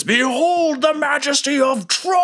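high, hammed-up heroic bellow; dragonborn proclamation. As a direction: announce yourself like a legendary dragon. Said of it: "And he says".

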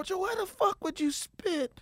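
high, strained disbelief; retold disbelief. Scolding Joey for spitting, high disbelieving cry. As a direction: push it up high, half-laughing in shock. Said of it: "I was like, I go".

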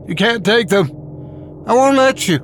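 put-on high-pitched girl's angry protest; screamed in anger. A narrator's reading. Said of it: "she screamed as she picked up".

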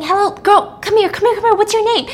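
put-on high, loud calling after the girl; urgent calling out. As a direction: call her back, pleading. Susie chases girl calling out.

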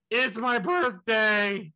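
loud, drawn-out dim-witted blurt; starstruck blurt. Retold or imitated. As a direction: imitate yourself starstruck, every word slow and loud. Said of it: "all I can muster is".

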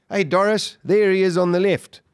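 mild acted shout from the mast; retold shout down. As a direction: call down to a crewmate. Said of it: "he just shouted down".

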